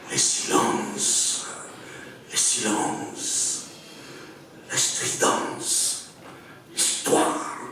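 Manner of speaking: slow declamation with long pauses, swelling from hushed lines to high cries; dramatic poem recital. Acted. Poet reads his Notre-Dame fire poem to an audience, dramatic declamation. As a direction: recite it in incantatory waves, near-whisper rising to ringing intensity. Je vais lire ce, ce poème.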